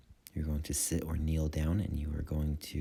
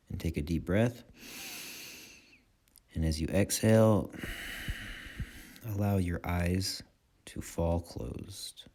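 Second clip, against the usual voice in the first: calm guiding voice in short phrases with long pauses; guided meditation. Acted. Meditation teacher guiding listeners' attention through breath and body, calm guided meditation. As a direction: lead them unhurriedly through the breath, leaving space after each phrase.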